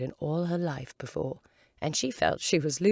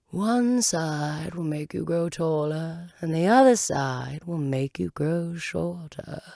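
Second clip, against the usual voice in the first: slow, louder caterpillar voice; the caterpillar's slow, loud parting remark; 6 dB louder, slower than usual. Acted. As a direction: drawl the riddle slowly while leaving.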